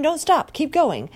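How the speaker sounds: loud, high scream of a demanding boss. A narrator's reading. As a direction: yell it like a furious boss who won't stop.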